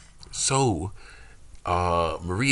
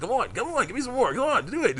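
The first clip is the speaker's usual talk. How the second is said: much higher, hammed-up taunt; goading trainer. Cliff goads her to punch harder, in a high mock taunt. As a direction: egg her on like a cocky sparring partner.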